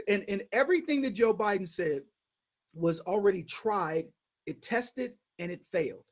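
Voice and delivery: usual speaking voice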